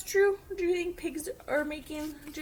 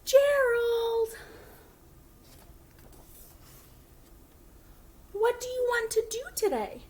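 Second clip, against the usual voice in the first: a bit higher, bright friendly piggy voice; cheerful Piggy; 10 semitones higher, 7 dB louder than usual. Acted. Piggy greeting her friend Gerald, cheerful and a bit higher. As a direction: call out to your best friend happily.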